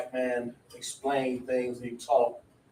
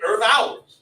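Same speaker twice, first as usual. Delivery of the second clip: loud, higher, punchy street phrasing; casual vernacular; 7 semitones higher, 7 dB louder than usual. Shown as a demonstration. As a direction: toss out EARTH OURS as one punchy phrase. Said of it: "I might just say".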